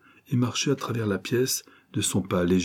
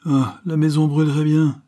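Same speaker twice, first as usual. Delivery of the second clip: exasperated; 7 semitones higher, 5 dB louder than usual. A narrator's reading. Father irritated by slow son.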